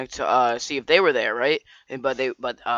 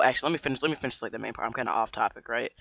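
ordinary delivery

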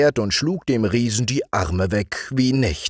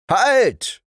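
sharp, high, loud stop; shouting; 12 semitones higher, 4 dB louder than usual. A narrator's reading. Shepherd stops the giant from eating, loud high shout. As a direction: bark the command to stop him. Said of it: "schrie auch der Hirt".

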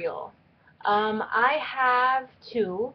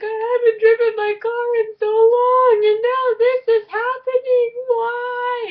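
high, loud, teary whine; whiny crying; 10 dB louder than usual. Retold or imitated. To mom on the phone, high teary whine. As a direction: wail it like a frustrated kid. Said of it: "I was like".

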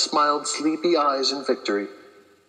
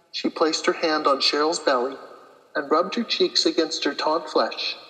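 usual speaking voice

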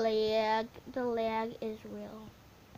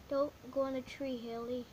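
ordinary talk, nothing marked

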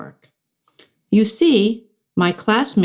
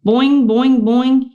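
high, loud twangy frog boings; boing like a cartoon frog; twangy frog call, higher and louder; funny twangy frog; 8 semitones higher, 5 dB louder, slower than usual. A narrator's reading. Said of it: "in his funny, twangy voice".

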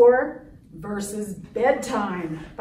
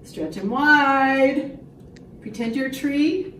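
usual speaking voice

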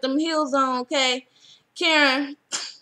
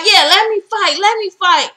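higher, louder begging to fight; mock eager shout; 5 semitones higher, 6 dB louder than usual. Karen shouts to be let back into the fight, eager and loud. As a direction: push to get back in. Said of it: "And Karen's like".

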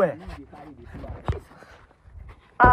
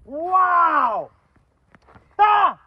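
high, drawn-out astonished wow; shocked; 14 semitones higher, much slower than usual. Real, not acted. Host hearing the pastor is the father, shocked. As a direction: let the shock ring out high.

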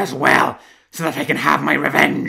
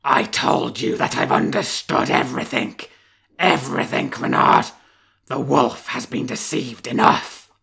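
savage threat; 5 dB louder than usual. Acted. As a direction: roar death threats down the well. Wolf rages at fox.

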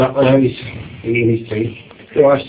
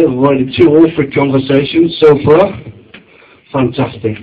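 usual speaking voice

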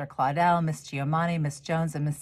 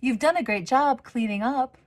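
high, warm-toned encouragement; a lightly voiced kind neighbor, higher; praising a girl next door; Miss Giamani's praise; 9 semitones higher, 4 dB louder than usual. A narrator's reading. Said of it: "Miss Giovanni told her".

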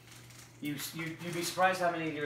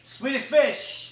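excited announcement; 8 semitones higher, 5 dB louder, much slower than usual. Real, not acted. Host announces correct guess.

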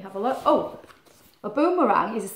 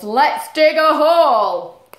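slow, louder, higher cheerful shout; character voice; 4 semitones higher, 8 dB louder, slower than usual. Acted. Animals in the digger deciding to dig, slow and loud. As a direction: announce it like a happy animal.

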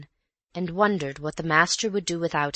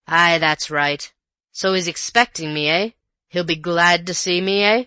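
mock him with a rough sneer; rough colonial; 7 dB louder than usual.

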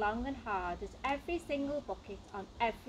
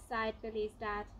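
ordinary delivery

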